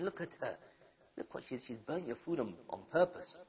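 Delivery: hushed, goading devil voice; devil's whisper. Acted. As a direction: needle him quietly like a tempter. Devil whispering temptation to husband.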